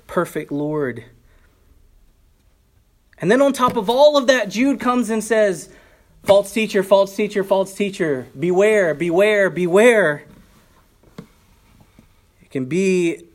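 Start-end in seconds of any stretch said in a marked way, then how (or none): 3.2-10.2 loud, high, urgent repeated warning; alarmed warning call. Retold or imitated. Preacher voicing Jude's repeated warnings to the church, loud and urgent. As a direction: sound the alarm, repeat each cry louder.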